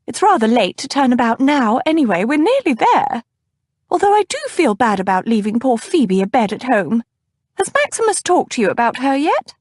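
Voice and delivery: put-on high, chattering older lady voice; fussy older cousin. A narrator's reading. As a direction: voice the cousin fretting on. Narrator voices Cousin Batilda.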